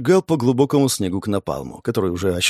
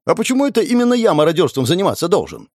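high, fast protest; indignant; 6 semitones higher, faster than usual. A narrator's reading. Shumov refusing to loot corpses, indignant. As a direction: object quickly, pitched up. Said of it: "возмутился Шумов".

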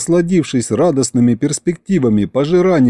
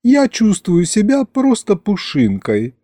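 put-on higher, light girlish voice; dreamy girl; 8 semitones higher than usual. Masha enjoying weightlessness, dreamy. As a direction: float happily like a feather.

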